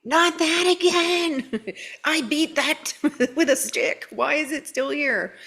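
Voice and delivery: whiny frustrated self. Retold or imitated. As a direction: whine it like a fed-up kid. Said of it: "But when I go".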